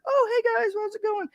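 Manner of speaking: imitating an excited greeting, very high; high-pitched excited voice. Trainer imitates son greeting dogs in the room, high-pitched and excited. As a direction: squeal it like someone thrilled to greet dogs. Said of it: "kinda has this high-pitched, excited voice".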